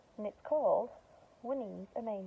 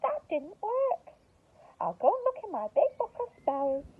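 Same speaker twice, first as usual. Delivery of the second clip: frustrated witch; 11 semitones higher, 5 dB louder than usual. Acted. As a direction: sigh it out then decide. Winnie voiced in story.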